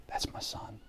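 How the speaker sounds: a father's stunned whisper at seeing his son; hushed whisper. Acted. Father spotting his lost son far off, whispering. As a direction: breathe it almost soundless, in awe.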